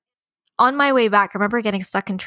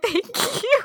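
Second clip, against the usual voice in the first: much higher, lightly teary little thank you; mock grateful. Woman retells thanking the tissue-bearing cast member, mock teary. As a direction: squeak out a thanks, half-laughing. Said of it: "I was like".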